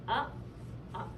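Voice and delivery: ordinary delivery, nothing marked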